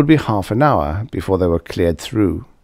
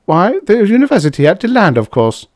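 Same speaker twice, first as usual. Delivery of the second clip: fast, higher prim reply; prim secretary; 12 semitones higher, 6 dB louder, faster than usual. A narrator's reading. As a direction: answer as if it's obvious. Miss Ferkel voiced.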